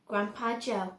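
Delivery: plain reading voice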